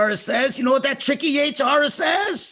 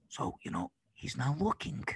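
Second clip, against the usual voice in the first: very soft, low insinuation; tempter's whisper; 9 semitones lower, 10 dB quieter than usual. Acted. Tempter coaxing a child in secret, hushed. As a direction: hush it right down, confiding. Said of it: "So then the Yetzer says".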